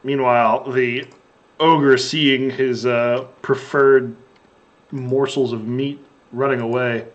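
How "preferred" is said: ordinary talk, nothing marked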